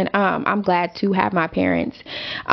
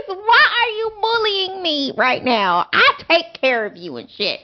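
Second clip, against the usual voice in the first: higher, aggrieved whine; whiny complaint; 8 semitones higher than usual. Retold or imitated. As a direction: plead like the victim. Complaining to her son.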